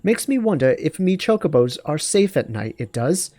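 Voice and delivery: high female voice with British-style dialect; her British-tinged dialect. Acted. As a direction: put on her lilting British country dialect. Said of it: "her dialect, her way of speaking".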